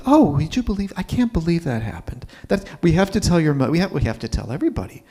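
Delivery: high, excited, tumbling words of a delighted grandmother; a grandmother who can't contain her excitement. Retold or imitated. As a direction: gush like a thrilled grandma. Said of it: "my grandmother smiling and saying".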